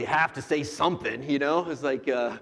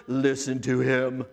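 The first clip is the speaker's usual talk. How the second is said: booming voice from the cloud, dropping low on the end; the deepest God voice he can manage; 4 semitones lower, 4 dB louder, slower than usual. Pretend. Preacher voicing God's words from the cloud, deep and booming. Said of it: "That's as deep as I can go".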